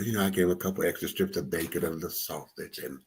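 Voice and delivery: ordinary talk, nothing marked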